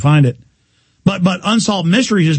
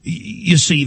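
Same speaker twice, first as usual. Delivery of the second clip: ordinary talk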